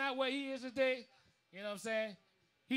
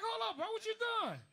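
retold surprise; 11 semitones higher than usual. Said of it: "I'm like".

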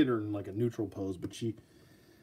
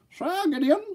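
grand announcer; 3 dB louder than usual. Pretend. Vlogger unveiling his knight figure, grand herald announcement. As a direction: announce him like a knight entering court.

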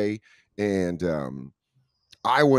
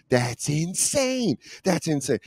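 mock disbelief; 7 semitones higher, slower than usual. Reacting to shocking story.

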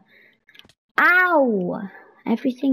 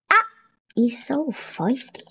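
normal talking voice

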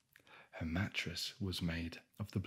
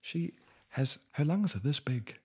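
high, louder disbelief; incredulous; 6 semitones higher, 3 dB louder than usual. Real, not acted. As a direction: climb up in mock outrage. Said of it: "mocking the story's logic".

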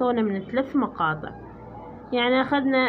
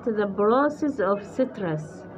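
slow text reading; 3 semitones lower, much slower than usual. Shown as a demonstration. Slowly reads the English text.

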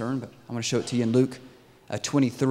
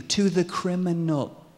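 ordinary talk

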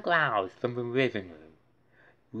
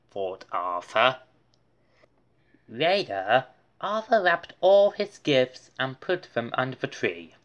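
plain reading voice

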